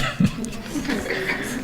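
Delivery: high breathy burst of laughter. Real, not acted. Laughing to the class at calling herself hyperactive. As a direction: break into a high quick laugh.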